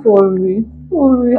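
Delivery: ordinary delivery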